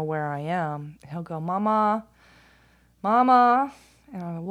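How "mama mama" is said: put-on loud, high child's call through the house; hollering. Son checking where mom is at home, hollering. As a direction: imitate a kid calling out for mom. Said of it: "So he just hollers".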